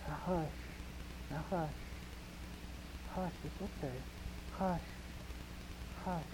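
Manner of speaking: very soft, tender shushing; soothing hush. Retold or imitated. Parent rocking a scared child, soft soothing hush. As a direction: rock a sick child to calm. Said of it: "petting 'em and they'll be going".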